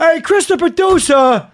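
high, loud, drawn-out holler; mock shout. Host calling out the producer, mock shout. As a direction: call out slowly at a high pitch, hamming it up.

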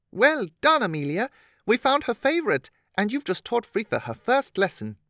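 put-on high, bright cheering girl; excited praise. Stephanie cheering.

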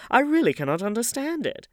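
high, loud bafflement; exclaiming. A narrator's reading. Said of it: "exclaimed the old lady".

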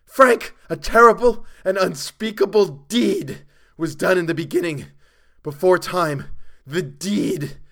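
high, very loud frantic outcry; hysterical scream. A narrator's reading. Chalmers telling Frank of the deed, screaming hysterically. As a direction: cry it out in mounting horror. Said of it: "His voice had risen to a scream".

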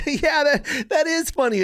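high-pitched, put-on agreement; mock amused kid. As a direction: imitate a kid going along with the joke. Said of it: "of course you're like".

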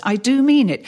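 ordinary delivery

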